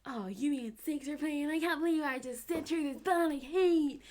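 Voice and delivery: put-on high, loud, drawn-out teenage angst; hammed-up emo teenager wailing. Complaining about a hated band on the bill, emo angst. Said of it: "You know, emo angst".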